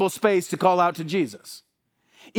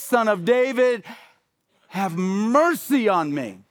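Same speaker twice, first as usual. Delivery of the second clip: desperate cry; 4 semitones higher than usual. Acted. Blind beggar calling out to Jesus, desperate and raised. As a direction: beg with everything, voice raised and straining.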